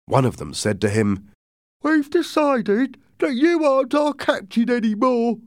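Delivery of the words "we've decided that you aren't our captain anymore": high, strained sailor voice; a pitched-up crewman challenging his captain; mutinous sailor. Acted. One of the men to Silver, high and defiant.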